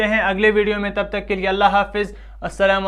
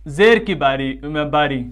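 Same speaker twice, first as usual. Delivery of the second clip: ordinary delivery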